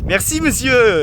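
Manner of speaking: sarcastic thanks, pitched high. Real, not acted. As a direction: thank him with mock gratitude. Mocking the man's detour.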